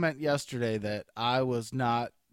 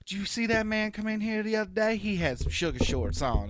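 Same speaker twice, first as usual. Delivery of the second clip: mock southern accent; 8 semitones higher than usual. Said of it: "she keep talking like this".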